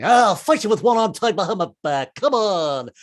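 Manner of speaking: a hint of a blustering Cowardly Lion; lightly voiced lion picking a fight. Comic does the Cowardly Lion, light blustery voice. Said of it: "This is the Cowardly Lion".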